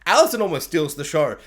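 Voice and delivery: normal talking voice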